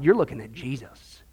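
low, soft, near-whispered imitation; quoted claim in a low hush; hushed revelation. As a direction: drop to an awed mock whisper. Quoted preacher's self-revelation, hushed.

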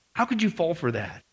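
low, soft disappointed question; subdued reproach. Acted. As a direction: drop it down, quietly reproachful. Same question repeated, subdued.

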